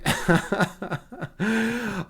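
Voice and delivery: real amused chuckle tailing off; laugh. Real, not acted. Streamer joking about meditating with a guest, laughing. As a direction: laugh at your own joke.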